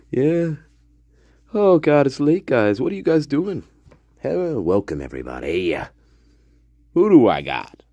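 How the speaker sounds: normal talking voice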